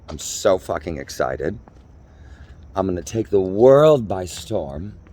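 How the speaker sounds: ordinary talk, nothing marked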